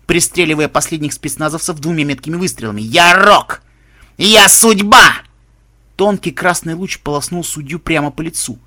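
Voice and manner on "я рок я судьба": loud, rasping, distorted battle cry; distorted roar. A narrator's reading. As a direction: bellow it, voice warped and raw. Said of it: "искажённым голосом прохрипел".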